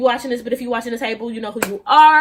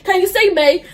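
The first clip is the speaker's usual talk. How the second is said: higher-pitched bad British imitation; terrible British accent; 5 semitones higher, 3 dB louder than usual. Imitating the suspected catfish on a call, bad British accent. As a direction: do a poorly faked British accent. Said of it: "I have a terrible British accent".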